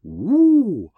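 high, drawn-out teasing ooh; mock ooh. Host mocking the killer's computer claim with a high ooh. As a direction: tease him with a sing-song ooh.

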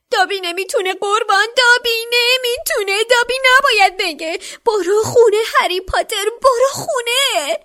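very high, loud squeak; squeaky elf voice. A narrator's reading. Elf refusing to tell Harry, shrill squeaking plea. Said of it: "با صدای جیغ جیغ مانندی گفت".

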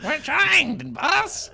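high, pushed demon whine; strained little demon pleading. Pretend. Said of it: "good demon voice".

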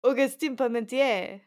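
name said in a French accent; French pronunciation of the name. Shown as a demonstration. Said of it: "Do your best French accent".

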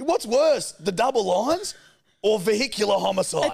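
high, loud retold challenge; indignant comeback. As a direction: argue it back in acted outrage.

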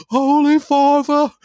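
high, loud, slow praying voice; mock old woman praying. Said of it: "she's there like".

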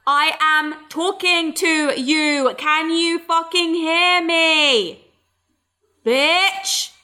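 slow, high, over-enunciated annoyance; imitating an infuriated daughter, drawn out; mock exasperation. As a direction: spell it out word by word, fed up. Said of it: "It's actually infuriating".

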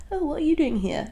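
high, soft, sweet mum voice; mock nicey-nicey. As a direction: feign sweetness toward an unwelcome visitor. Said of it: "she's all nicey nicey to her".

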